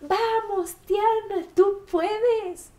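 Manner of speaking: high, loud cheering shout. Retold or imitated. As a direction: cheer her up the last metres. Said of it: "te grita". (la gente en la cima le grita ánimos, grito agudo y fuerte)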